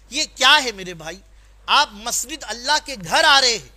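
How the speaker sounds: preacher chiding the congregation over ripped jeans, loud rebuke; scolding rebuke. Acted. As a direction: call out the careless dress from the pulpit. Preacher scolding worshippers' torn jeans.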